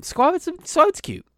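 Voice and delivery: very high squeaky cutesy voice. Acted. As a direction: squeal it out like baby talk. Gushing again.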